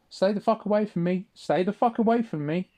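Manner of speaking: frightened shout of stay away; retold yell. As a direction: yell at him to back off. Retold yelling at knife man.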